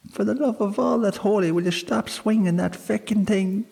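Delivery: a high, fast squeaky fairy yell; tiny Irish sprite yelling in alarm; angry little creature. A narrator's reading. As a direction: shriek at her to stop swinging. Tiny creature shouts from briars.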